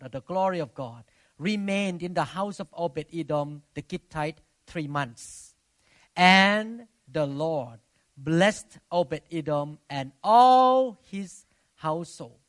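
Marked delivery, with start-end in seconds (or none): 6.2-12.4 booming, drawn-out preaching; loud slow proclamation. Acted. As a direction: proclaim it slowly and loudly.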